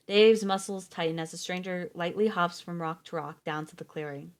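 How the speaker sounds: usual speaking voice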